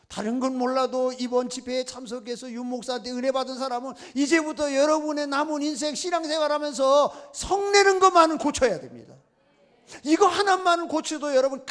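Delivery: impassioned pulpit urging; climactic exhortation. Real, not acted. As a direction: drive the point home at full voice. Preacher's climactic appeal to congregation.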